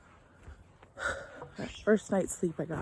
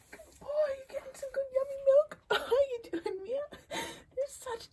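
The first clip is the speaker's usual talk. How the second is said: much higher, teary cooing to the animals; 5 dB quieter than usual. Real, not acted. Praising the nursing calf and cow, tender high cooing.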